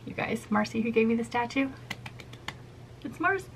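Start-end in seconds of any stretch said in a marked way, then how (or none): 3.0-3.5 much higher, squealing the name; excited squeal. Real, not acted. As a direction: squeal her name with delight.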